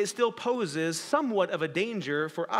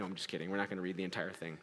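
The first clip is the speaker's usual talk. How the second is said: quiet aside; 12 semitones lower, 13 dB quieter, much faster than usual. Real, not acted. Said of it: "joking aside".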